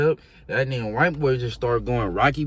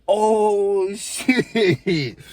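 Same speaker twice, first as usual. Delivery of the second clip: laughing exclamation; 8 semitones higher, 5 dB louder than usual. Retold or imitated. Reacting to the slam.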